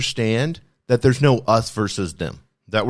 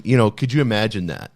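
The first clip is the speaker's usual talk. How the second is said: ordinary delivery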